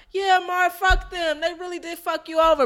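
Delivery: high, eager agreement; rooting for the underdog, voice up high; siding with him. Retold or imitated. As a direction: cheer him on. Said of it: "you're like".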